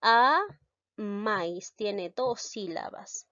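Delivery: maíz said slowly, syllable by syllable; slow teaching demo. Shown as a demonstration. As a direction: spell out maíz carefully for the class. (pronunciación lenta y silabeada de "maíz")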